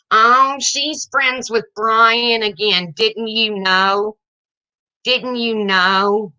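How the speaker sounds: put-on slow, sing-song taunting like a child; slow mocking playground taunt; childish mock gossip. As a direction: mimic a petty seven-year-old, drawn out. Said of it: "You sound like you're seven".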